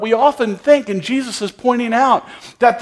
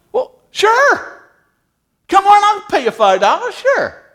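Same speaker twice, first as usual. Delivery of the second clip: much higher, cheerful yes of a generous boss; eager vineyard owner; 11 semitones higher than usual. Acted. Vineyard owner gladly agreeing to pay five dollars, high and eager. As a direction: happily agree like a kind boss.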